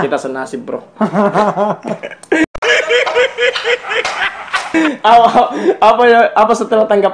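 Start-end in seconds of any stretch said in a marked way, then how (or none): 1.0-4.9 big laugh. Real, not acted. Host laughing with his guest about exes. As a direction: crack up at the shared bad luck.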